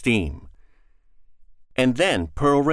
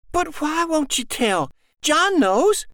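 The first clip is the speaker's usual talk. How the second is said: put-on higher, plaintive child asking; a small girl pressing her question; little girl. Girl insists.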